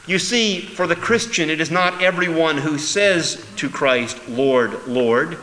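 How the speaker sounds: loud, slow, emphatic preaching; preaching at full force. Acted. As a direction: drive the call home from the pulpit.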